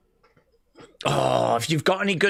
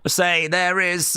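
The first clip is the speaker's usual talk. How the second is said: ordinary talk, nothing marked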